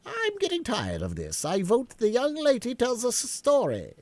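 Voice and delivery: high, bored voice; lightly voiced fed-up Hare; bored March Hare. A narrator's reading. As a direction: whine to get a story.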